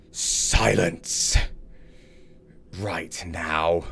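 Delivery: loud, commanding bellow to silence the crowd; booming voice. Acted. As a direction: boom it out like a captain. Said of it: "a booming voice".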